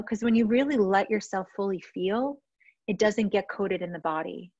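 ordinary talk

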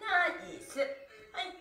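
ordinary talk, nothing marked

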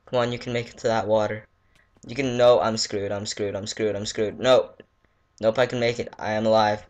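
ordinary talk, nothing marked

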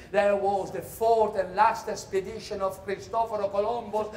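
ordinary talk, nothing marked

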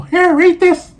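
high, loud mock wife voice; squeaky bossy wife impression; mimicking wife. Wife quoted handing script.

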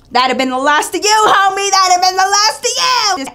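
rising to a high, loud exclamation; indignant warning. Retold or imitated. Girlfriend telling David he chose well, loud mock threat. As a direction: build from firm to hollered threat.

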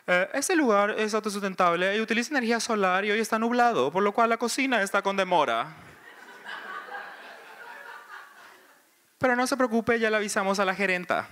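voz aguda y dulzona de mesera; mesera condescendiente. Retold or imitated. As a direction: explica la demora con falsa amabilidad. Mesera explica la demora. (mesera dulzona y aguda)

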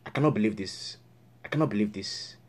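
low, soft repeated grumble; muttered disbelief. Acted. Counselor cut off by voicemail, muttered disbelief. Muttering after the call fails.

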